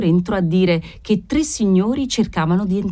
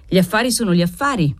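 usual speaking voice

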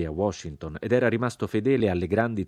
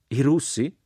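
astonished echo question, higher and louder; deeply astonished; 7 semitones higher, 5 dB louder than usual. A narrator's reading. Jack echoes the prince's answer, deeply astonished. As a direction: exclaim it as a disbelieving question. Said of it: "esclamò Jack profondamente meravigliato".

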